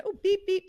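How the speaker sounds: timer beeps. Retold or imitated. Imitating the timer.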